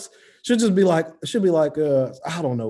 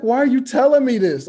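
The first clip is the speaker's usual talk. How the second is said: loud, high appalled protest; mock anguish; 10 semitones higher, 8 dB louder than usual. Son pleading with oversharing mom, loudly appalled. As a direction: cry out in comic horror. Retold horror at mother's disclosure.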